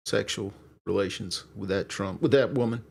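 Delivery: light presidential impression with halting pauses; halting, understated presidential voice; Clinton. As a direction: lightly imitate a president's measured address. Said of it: "Need more raspiness on Clinton".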